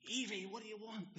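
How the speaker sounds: high, sharp retold outburst at the child; angry outburst. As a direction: snap at her, anger boiling over. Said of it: "I boiling up".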